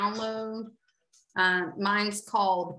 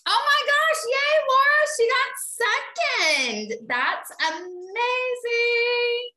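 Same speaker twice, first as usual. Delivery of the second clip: excited cheer; 7 dB louder than usual. Real, not acted. Host cheering Laura's second place, high loud squeal. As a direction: burst out cheering for a friend's win.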